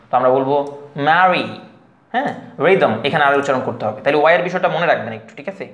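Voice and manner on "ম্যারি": "ম্যারি" said slowly with an audible R; R pronounced. Shown as a demonstration. Teacher giving learners the example word, R sounded. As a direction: model "ম্যারি", sounding the R before the final vowel. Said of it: "আর এর উচ্চারণ আপনাকে করতে হবে".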